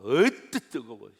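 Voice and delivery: loud, drawn-out mock yelp; reacting to scalding water. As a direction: act out stepping into hot water. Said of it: "떡 들어가는데 어떡합니까?". (뜨거운 물에 덴 듯 크게 늘여서)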